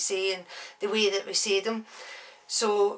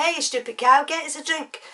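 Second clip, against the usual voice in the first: higher, mock English accent; put-on English accent; 7 semitones higher, 4 dB louder than usual. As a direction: call it out in a dodgy English accent. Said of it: "it was, might be English".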